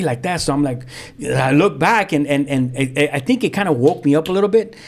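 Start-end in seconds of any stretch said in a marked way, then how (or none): none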